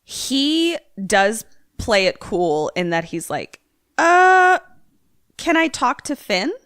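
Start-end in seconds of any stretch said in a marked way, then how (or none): 4.0-6.5 awkward fake-casual dad. Dad fakes casual on phone.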